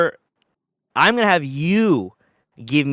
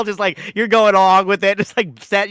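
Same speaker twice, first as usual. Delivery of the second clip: normal talking voice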